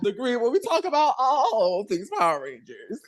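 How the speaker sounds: bright announcer-style welcome; podcast host intro. Acted. Host to podcast listeners, announcer-style welcome. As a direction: sell the show to the listeners.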